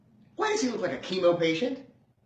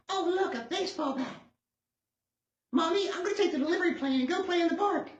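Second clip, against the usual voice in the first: put-on much higher, chirpy child voice; cartoon kid voice; 14 semitones higher than usual. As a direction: squeak it like an excited little boy. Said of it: "it's always something like".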